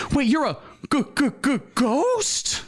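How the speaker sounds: hammed-up scared stutter; stammering fright. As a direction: stammer like you've seen a ghost. [name] stammering at ghost.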